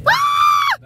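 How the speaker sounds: very high, loud scream; frightened scream. Real, not acted. Child calling daddy for help, frightened scream. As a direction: shriek at full force.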